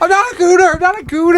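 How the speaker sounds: high-pitched hammed-up denial; put-on squeal of denial; mock protest. Quoting a mock indignant denial.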